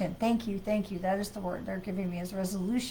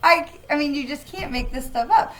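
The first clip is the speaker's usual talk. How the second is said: laughing in disbelief; 6 semitones higher, 8 dB louder than usual. Real, not acted. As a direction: laugh through the words, amused. Card reads "Time for a nap.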